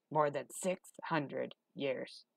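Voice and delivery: very slow, soft gravelly hunchback; ponderous old Igor; low gravelly voice. A narrator's reading. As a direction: count it out slowly in a rough voice. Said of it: "His voice was low and gravelly".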